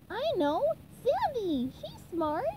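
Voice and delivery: high, bright-idea exclamation; high-pitched character voice; cartoon sponge voice. A narrator's reading. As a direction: pipe up with a sudden idea.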